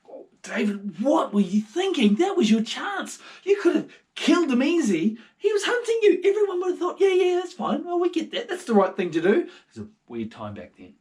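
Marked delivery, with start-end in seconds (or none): 0.1-9.5 high loud exasperated men; frustrated soldiers. Acted. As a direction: complain in disbelief, pitch way up. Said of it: "David's men were like".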